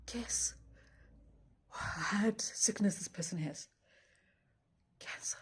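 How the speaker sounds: whisper. Real, not acted. Revealing the friend's prayer request to viewers, whispering. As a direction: whisper it like a dreaded secret.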